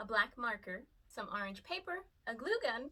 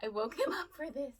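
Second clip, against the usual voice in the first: ordinary talk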